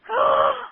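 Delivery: a sharp high yelp like a hurt puppy; imitated dog yelp. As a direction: squeak out the dog's pained cry. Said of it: "you hear Tater crying".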